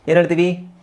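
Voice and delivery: ordinary talk, nothing marked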